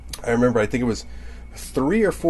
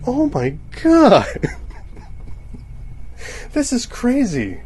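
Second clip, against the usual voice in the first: high, drawn-out "Oh my God"; amazed gasp; 11 semitones higher than usual. Retold or imitated. Shopper seeing giant Halloween decorations at Home Depot, high and amazed. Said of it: "I'm like".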